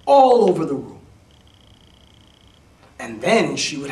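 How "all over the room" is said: exclaimed. A narrator's reading. Feathers fly everywhere.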